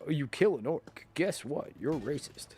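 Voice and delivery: normal talking voice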